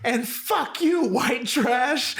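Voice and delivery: high, slow mock outburst; woman hurling insults. Driver turns on the silent girlfriend, high mock-angry imitation. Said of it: "And she's like".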